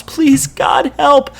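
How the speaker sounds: very high, loud desperate cry; a child screaming for help during the attack; child's scream. A narrator's reading. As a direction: beg for help in terror. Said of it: "the first child screams again".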